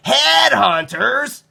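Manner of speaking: very high, drawn-out alarmed question; startled squeak of fear; boy hearing of headhunters, slow and high. A narrator's reading. Nicholas alarmed.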